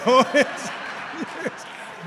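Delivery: loud breathy cackle; loud mocking chuckle; mocking laugh. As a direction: laugh at the newcomer's expense. Mimicking Jim's mocking laugh.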